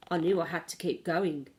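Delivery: ordinary delivery, nothing marked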